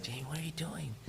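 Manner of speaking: very soft, almost under the breath; retold hushed reproach. Teacher to tearful student in the hallway, retold hushed reproach. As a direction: imitate a teacher quietly taking a student to task.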